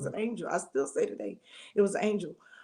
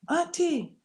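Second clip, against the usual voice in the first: calling out; 8 semitones higher, slower than usual. Retold or imitated. Voicing the niece's call.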